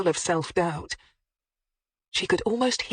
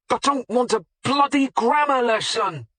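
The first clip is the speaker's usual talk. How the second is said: loud, higher irritated outburst; rather loud; 7 semitones higher, 5 dB louder than usual. A narrator's reading. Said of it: "the discomfited butcher said rather loudly".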